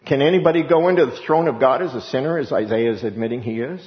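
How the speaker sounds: usual speaking voice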